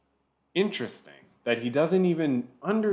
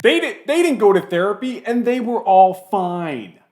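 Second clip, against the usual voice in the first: mock pompous ranter; 6 semitones higher, 6 dB louder than usual. Mocking the clip's war rant.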